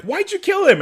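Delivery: high-pitched, exasperated question; mock indignant. Stefan asking Damon about killing Mason, mock indignant. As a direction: voice jumps up in mock protest.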